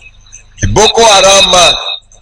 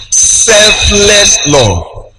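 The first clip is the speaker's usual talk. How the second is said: stretched out word by word, very slow; slow emphatic close; much slower than usual. Acted. Preacher sealing the sermon's theme to the church, very slow and weighty. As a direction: let each word hang as a final point. Closing line drawn out slowly.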